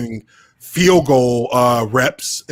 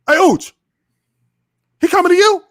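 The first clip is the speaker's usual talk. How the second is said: much higher and louder call across the line; urgent call; 6 dB louder, faster than usual. Retold or imitated. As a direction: shout the warning to a teammate. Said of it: "He turns and looks at me and says".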